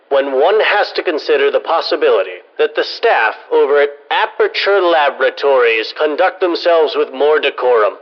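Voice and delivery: ordinary talk, nothing marked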